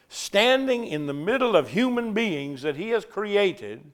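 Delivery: ordinary delivery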